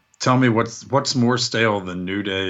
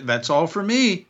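mock interviewer; 8 semitones higher than usual. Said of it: "She threw back to the".

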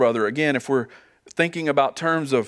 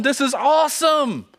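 much higher, bright excited praise; joyful rejoicing; 10 semitones higher, 3 dB louder than usual. Retold or imitated. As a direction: gush with joy at the brother's return. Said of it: "Does he rejoice and say".

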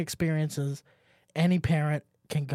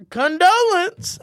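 mock dramatic; 13 semitones higher, 8 dB louder, slower than usual. Drawn-out mock announcement.

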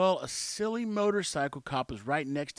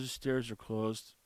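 mumbled quietly; 7 semitones lower, 6 dB quieter, slower than usual. Retold or imitated. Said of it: "He goes".

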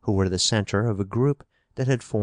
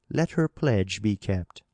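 ordinary delivery, nothing marked

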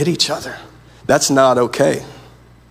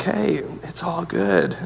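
soft, slow, higher-pitched reassurance; easygoing tempter; 4 semitones higher, 6 dB quieter, slower than usual. Acted. The enemy lulling Adam and Eve, casual soft reassurance. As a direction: coax them gently, as if nothing is wrong. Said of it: "the enemy came like".

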